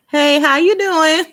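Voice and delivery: drawn-out, high-pitched cheery hello; drive-through greeting. Retold or imitated. Woman calling to drive-through worker, high drawn-out greeting. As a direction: sing it out high and loud, stretched slow.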